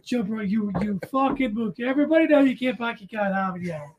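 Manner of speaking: mock a Boston local scolding; Boston accent. Boston local scolding a tourist, fast Boston accent. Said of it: "working on that accent".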